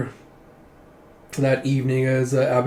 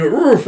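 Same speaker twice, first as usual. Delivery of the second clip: high, loud grunt of surprise; imitated yelp of someone grabbed; startled oof; 10 semitones higher, 8 dB louder than usual. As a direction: let out a sudden startled "oof". Startled man grabbed from behind.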